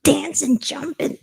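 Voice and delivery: high, loud burst of joy; excited. Real, not acted. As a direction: call it out as if leaping up. Acting out joyful movement.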